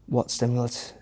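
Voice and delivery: normal talking voice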